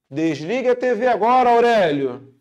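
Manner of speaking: loud, sharp order, name shouted at the end; stern command. Acted. Teacher voicing a parent ordering Aurélio off the TV, loud stern command. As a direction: order Aurélio to switch the TV off now.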